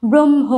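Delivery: ব্রহ্ম loud, "brom-ho" with firm m; ব্রহ্ম with dominant m. Shown as a demonstration. Teacher repeating the rule, ব্রহ্ম with strong m. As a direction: push the m in ব্রহ্ম over the h. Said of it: "হয়ের থেকে ময়ের প্রাধান্য বেশি".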